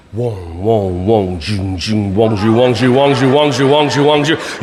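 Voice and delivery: very low wong and zhu buzzes, slowly climbing; deep laser wong noises. Pretend. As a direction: chant the laser beats deep in the chest. Laser game sound effects.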